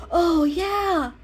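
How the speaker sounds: high, loud eager cry; excited exclaim. A narrator's reading. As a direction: burst out with delight. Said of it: "Mocha exclaimed".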